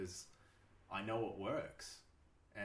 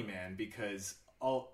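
usual speaking voice

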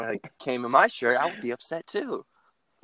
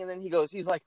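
normal talking voice

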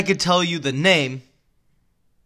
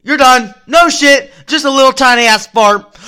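ordinary delivery

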